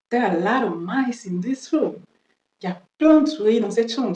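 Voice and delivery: ordinary talk, nothing marked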